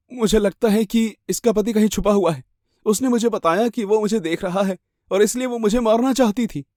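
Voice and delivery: high, anxious account; shaken victim. Acted. Victim telling a policeman about the hidden husband, high and anxious.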